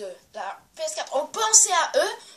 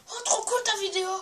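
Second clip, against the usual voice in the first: gushing fan; 8 semitones higher than usual. Retold or imitated. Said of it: "Tu dis".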